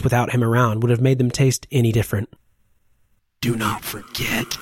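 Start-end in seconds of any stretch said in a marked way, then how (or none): 3.4-4.6 faint, slow, fading call; barely there, slow and weakened; weak faint voice. A narrator's reading. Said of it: "much weaker".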